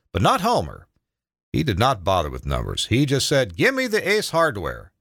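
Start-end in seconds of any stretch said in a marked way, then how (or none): 3.6-4.9 high, slow order to the operator; lightly acted commanding demand. A narrator's reading. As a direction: pitch it up and draw out the order. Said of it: "His commanding voice did the rest".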